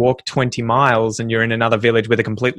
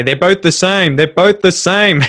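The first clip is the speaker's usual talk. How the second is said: imitated wife; 6 semitones higher, 5 dB louder, slower than usual. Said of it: "My wife would say".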